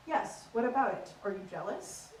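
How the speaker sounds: restrained, prim received pronunciation; posh English accent. Acted. Comic translating the challenge, in restrained posh English. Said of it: "average conversation goes a little bit like this".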